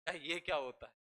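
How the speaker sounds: normal talking voice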